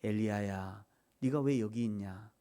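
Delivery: low, soft, gentle question; hushed, gentle voice of God; still small voice. Shown as a demonstration. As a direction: call the name softly and low. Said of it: "세미한 음성으로 와서 세미한 음성이".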